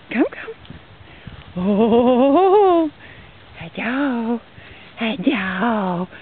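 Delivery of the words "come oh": usual speaking voice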